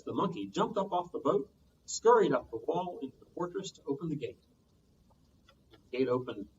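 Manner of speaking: usual speaking voice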